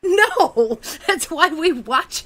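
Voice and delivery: laughing no, high and loud. Real, not acted. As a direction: burst out laughing in protest. Laughing protest at co-host.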